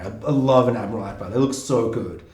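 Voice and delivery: ordinary talk, nothing marked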